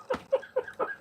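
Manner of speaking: high, wheezy laugh. Real, not acted. Streamer cracking up at his own silly voice, laughing. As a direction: crack up at your own joke.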